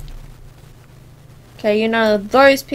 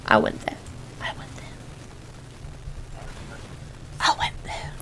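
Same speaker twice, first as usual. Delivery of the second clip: hushed echo of your own remark; very soft, voiceless repeat; fading whisper; 13 dB quieter than usual. Acted. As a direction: let it sink away into a whisper.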